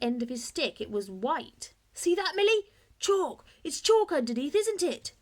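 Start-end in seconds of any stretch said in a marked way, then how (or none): 1.9-5.1 high, loud, breathless excitement; excited. A narrator's reading. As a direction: show her the chalk, thrilled. Bertie bursts in with discovery.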